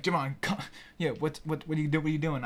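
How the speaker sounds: flustered false starts, words repeated; stuttering. Retold or imitated. Storyteller replays confronting his tripping friend, stuttering. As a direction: stumble and restart the question. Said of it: "stuttering and shit".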